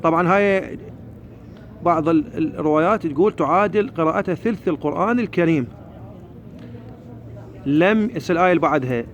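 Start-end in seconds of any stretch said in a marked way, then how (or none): none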